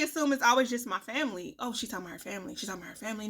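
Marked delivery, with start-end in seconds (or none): 1.6-3.3 mocking gossip. Mocking what everybody assumes.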